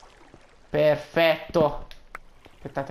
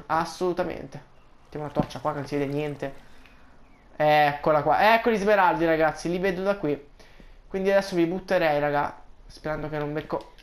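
ordinary talk, nothing marked

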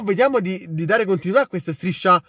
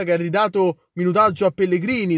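ordinary talk, nothing marked

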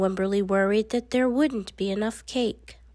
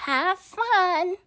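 higher, bright parent's goodbye; cheerful parent; 7 semitones higher than usual. A narrator's reading. Parents saying goodbye at school, cheerful and high. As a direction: wave her off cheerfully.

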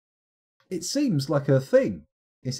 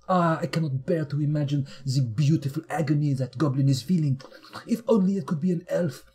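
hammed-up French chef savouring the agony; purring French gourmet voice; a villain in a French accent relishing pain; mock French accent. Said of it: "apologies to any French people there".

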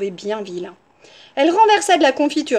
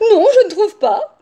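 high, loud, sing-song sweetness; syrupy wolf, pitched up; extra-kind voice; 9 semitones higher, 8 dB louder than usual. A narrator's reading. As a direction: disagree as sweetly as can be. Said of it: "d'une voix encore plus gentille".

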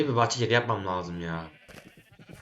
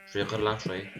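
ordinary talk

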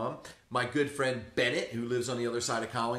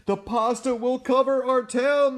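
frightened cry; 12 semitones higher, 9 dB louder than usual. Acted. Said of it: "they cried".

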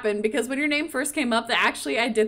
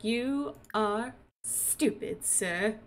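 very slow, softer put-down; mock cold insult; 7 dB quieter, much slower than usual. Jasmine answering the dismissive suitor, slow and quietly scornful. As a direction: space out each word, quietly cutting.

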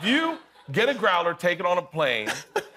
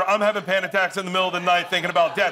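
usual speaking voice

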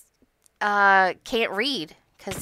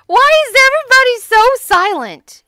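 exasperated shout; 12 dB louder than usual. Real, not acted. Calling out to silent friends, exasperated shout. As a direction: holler it at the whole group.

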